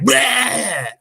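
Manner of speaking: sudden high "bleh" to startle; spring out with a high mock monster cry; imitated jump-scare yell. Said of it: "jump out of a room and be like".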